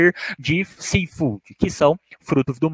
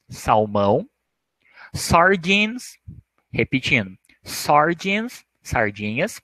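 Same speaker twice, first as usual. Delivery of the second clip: ordinary delivery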